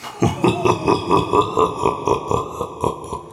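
high whispered monster cackle; a breathy evil laugh that fades into shadow; hideous laugh. Acted. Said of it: "The monster laughed a hideous laugh".

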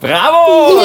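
high, loud, delighted cheer; excited bravo. Real, not acted. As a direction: cheer the performance. Praising the song.

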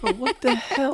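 high-pitched incredulous reaction; retold disbelief. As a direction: act out baffled disbelief, voice jumping up.

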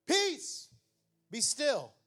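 high call of peace, then settled; calm the storm with one command; commanding Jesus. Retold or imitated. Jesus speaking to the storm, commanding.

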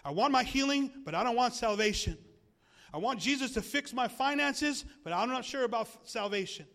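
hammed-up grabby believer; mock believer. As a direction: parody a selfish churchgoer's wants. Mocking a selfish believer.

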